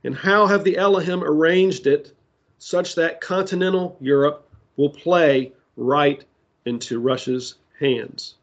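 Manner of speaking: ordinary talk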